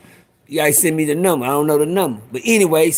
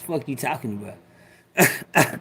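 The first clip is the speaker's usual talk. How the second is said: [name] voice; 4 semitones lower, 5 dB quieter, slower than usual. Shown as a demonstration. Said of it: "in my [name] voice".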